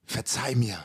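low, hushed repeated plea; very quiet. Retold or imitated. Man begging his partner's forgiveness over dinner, very quiet. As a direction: murmur the apology softly. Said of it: "nochmal so ganz leis gesagt".

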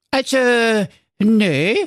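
put-on high, thin old nanny goat; a grateful old goat's voice; frail breathless old person.

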